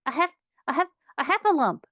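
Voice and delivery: high, puffed, broken stammer; breathless and frightened, restarting the word; Piglet out of breath. A narrator's reading. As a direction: gasp it out in high bursts. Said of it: "breathing so hard he could only speak".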